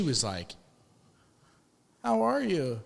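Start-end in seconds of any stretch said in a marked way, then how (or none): 2.0-2.8 high-pitched greeting in a nurse's voice; imitated nurse. Nurse greeting her old acquaintance on the ward, imitated high voice.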